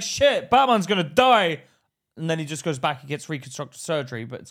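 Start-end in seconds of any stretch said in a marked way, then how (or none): 0.0-1.6 mock alarm. Acting a shocked viewer.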